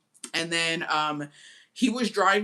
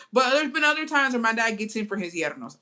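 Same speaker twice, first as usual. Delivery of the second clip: ordinary talk, nothing marked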